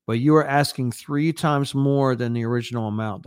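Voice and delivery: ordinary delivery, nothing marked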